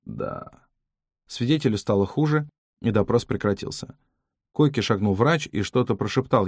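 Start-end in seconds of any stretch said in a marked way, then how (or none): 0.1-0.7 breathless whispered yes; faint whisper. Acted. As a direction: fade into a whisper. Dying witness, fading, worsening. (еле слышный шёпот)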